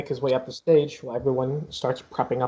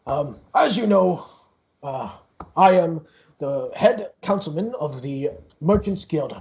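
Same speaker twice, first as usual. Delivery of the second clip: a proud half-orc guild leader, pitched up at the start; councilman Gareth; 4 semitones higher, 4 dB louder than usual. Acted. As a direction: speak as the grand host welcoming the performers. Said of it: "looks at you and goes".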